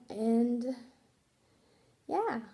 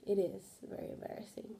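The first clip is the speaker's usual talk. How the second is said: fully whispered, no voice; 3 semitones lower, 10 dB quieter than usual. Real, not acted. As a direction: breathe the words in a whisper. Repeats line, then whispers it.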